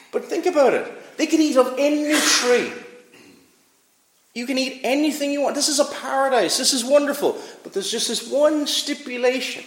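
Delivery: excited high marvel. Acted. Preacher marveling at the paradise given to Adam and Eve, lifted high.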